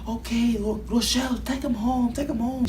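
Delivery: high-pitched, pleading "take him home"; urgent pleading friend. Retold or imitated. Friend begging Rochelle to take him home, high urgent plea.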